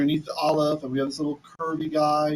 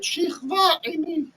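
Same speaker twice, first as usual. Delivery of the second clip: high, slow, drawn-out seductive plea; seductive wife; 12 semitones higher, much slower than usual. Acted. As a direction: coax him slowly, pitched high like the temptress. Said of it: "Vatomer, and she said".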